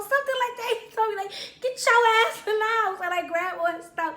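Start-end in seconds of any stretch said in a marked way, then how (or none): 1.6-3.0 high, loud imitation of a command; retold yelled order. Boyfriend to girlfriend in the hallway, loud retold order. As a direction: order her indoors.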